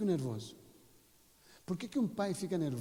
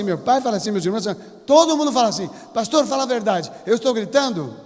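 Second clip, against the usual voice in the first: shouting husband loudly insisting he never shouts; full shouting; 7 semitones higher, 18 dB louder than usual. Acted. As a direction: bellow it, high-pitched and very loud. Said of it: "Ele grita".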